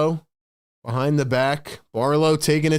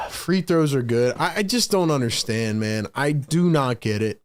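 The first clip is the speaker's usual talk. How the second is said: usual speaking voice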